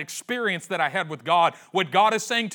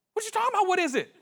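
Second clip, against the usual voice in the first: much higher, put-on grumpy old man voice; exasperated old priest; much faster than usual. Eli to Samuel woken again, a high, put-on grumpy old man voice. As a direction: act woken from sleep and fed up.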